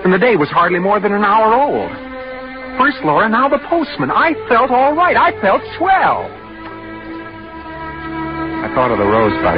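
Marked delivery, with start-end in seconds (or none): 0.0-6.3 exasperated, high-pitched self-defence; indignant protest. Acted. Protests he feels fine.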